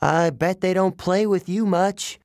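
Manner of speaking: put-on nervous boy calling up to Cerberus, coaxing. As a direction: sweet-talk the monster from below. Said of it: "I called up".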